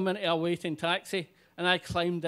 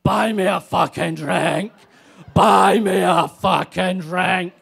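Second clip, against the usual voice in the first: bellowed over and over, far louder; drunken yell; 11 dB louder, slower than usual. Retold or imitated. Drunk woman bellowing at the narrator for a drink. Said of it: "repeating her mantra".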